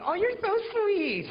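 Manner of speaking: put-on high, loud and slow swooning girl; girlfriend voice. Mimicking the girl he hooked up with, high and swooning. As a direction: gush it like a smitten girl. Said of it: "And she's like".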